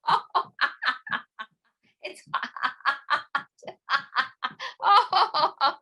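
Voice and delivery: high laughing while hopping; hot sand laughter. Pretend. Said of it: "hot sand laughter".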